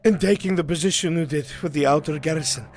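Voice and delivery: winded messenger. Acted. Runner telling the lord about a merchant, breathless. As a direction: keep panting as you explain.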